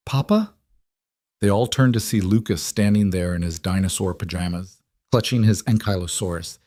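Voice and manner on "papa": put-on high, questioning little boy; small child's voice. A narrator's reading. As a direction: voice a small child calling from the doorway. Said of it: "a small voice from the doorway".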